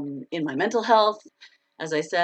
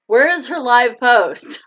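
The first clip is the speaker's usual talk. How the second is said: louder, higher, drawn-out imitation of followers; mock complaint; 6 semitones higher, 8 dB louder, slower than usual. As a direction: imitate impatient fans, slowly and loudly. Mimics puzzled followers on Facebook.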